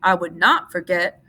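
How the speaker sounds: loud, slow protest; Lenny protesting loudly. A narrator's reading. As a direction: insist loudly and slowly. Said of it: "Lenny said loudly".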